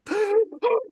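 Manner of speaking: imitated high, sharp gasp; gasp for air. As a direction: act out sucking air after being released. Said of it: "He's, like, gasping for air".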